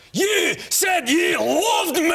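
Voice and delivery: high, slow, strained shout in a botched Irish accent; a tender line blown out into a wailing shout; shouted, cracking. Shown as a demonstration. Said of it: "what came out was".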